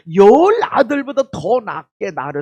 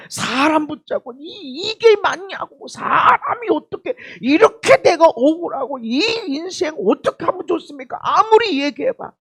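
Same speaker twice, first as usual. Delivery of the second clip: much higher, crying complaint of injustice; imitating a wronged, sobbing plea; tearful pleading; 8 semitones higher, 4 dB louder than usual. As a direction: plead through tears for someone to listen. Grieving person pleads to others.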